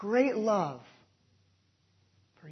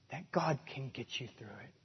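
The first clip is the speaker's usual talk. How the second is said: ordinary talk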